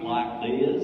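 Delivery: exaggerated American Southern drawl; generic Southern. Shown as a demonstration. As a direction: slip into a slow generic Southern voice. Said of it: "I go into this sort of generic thing".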